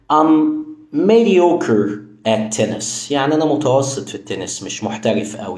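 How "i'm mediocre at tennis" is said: I'm mediocre at tennis, drawn out very slowly; slow example. Shown as a demonstration. Teacher reading the example slowly. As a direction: read it word by word. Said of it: "لما أقول لك مثلًا".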